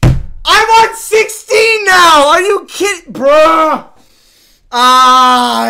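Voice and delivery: very high, full-volume wail; anguished scream. Real, not acted. Gamer seeing his death count, anguished screaming. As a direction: scream in disbelief, drawn out.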